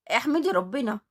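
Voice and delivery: usual speaking voice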